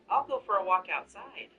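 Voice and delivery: embarrassed husband slipping out of the room, awkward. A narrator's reading. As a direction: blurt it out awkwardly to escape. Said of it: "I said awkwardly".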